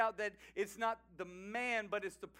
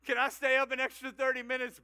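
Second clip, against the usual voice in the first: put-on high, loud, quick child voice; hammed-up kid's request; pleading child; 5 semitones higher, 9 dB louder, faster than usual. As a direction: mimic a kid begging at bedtime. Voices a child asking a parent.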